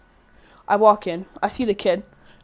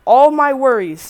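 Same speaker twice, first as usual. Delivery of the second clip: all my worries, slowed and pushed out loud; weight of worries; 6 semitones higher, 11 dB louder, slower than usual. Real, not acted. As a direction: lean hard on "all my worries". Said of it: "just complete fucking stress like an anvil".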